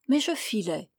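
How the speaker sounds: ordinary delivery, nothing marked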